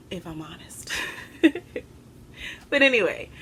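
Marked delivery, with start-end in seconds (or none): 1.0-1.8 breathy laugh. Real, not acted. Embarrassed after admitting feelings.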